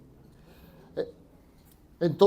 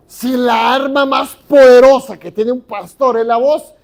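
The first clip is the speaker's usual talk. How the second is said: preaching emphasis; 4 semitones higher, 10 dB louder than usual. Acted. Preacher stressing the point.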